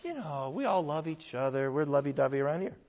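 soft, trailing mock leniency; mock easygoing reassurance. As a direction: brush it off softly, as if all is forgiven. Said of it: "he's not gonna say".

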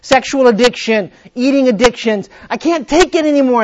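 desperate plea. Acted. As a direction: plead like you've hit bottom. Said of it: "you go".

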